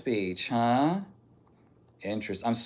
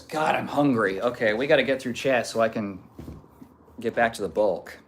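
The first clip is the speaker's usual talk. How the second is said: ordinary talk, nothing marked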